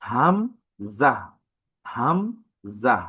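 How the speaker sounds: হামজাহ slowly from the throat; slow demo of হামজাহ; throat sound demo. Shown as a demonstration. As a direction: show where হামজাহ starts in the throat. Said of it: "কণ্ঠনালীর শুরু হতে উচ্চারণ হয়".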